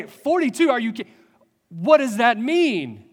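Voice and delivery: exasperated beings confronting the computer; incredulous outburst. Retold or imitated. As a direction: demand an explanation in disbelief. Beings react in disbelief.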